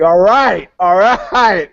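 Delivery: loud, high pumped-up all right; rally yourself like a general going to battle; mock fired-up. Mock-heroic general bluster.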